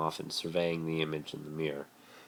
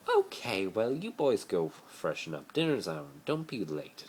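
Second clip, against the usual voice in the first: Narcissa's voice; 4 semitones higher than usual. A narrator's reading. Narcissa sends the boys off to freshen up, brisk and bright.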